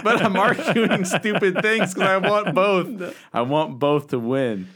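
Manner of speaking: laughing. Retold or imitated. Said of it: "all of a sudden I'm like".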